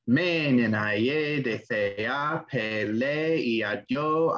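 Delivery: slow Greek recitation, long syllables stretched hard; exaggerated Greek meter. Shown as a demonstration. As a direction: chant the Iliad, drawing out every long syllable. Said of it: "I'm gonna really exaggerate the long and the short syllables".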